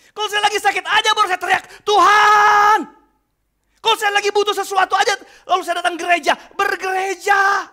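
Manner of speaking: high, loud shouting sermon; preaching at full force. Acted. Pastor shouting at the congregation, full-force preaching. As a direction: cry it out to the church like a revival preacher.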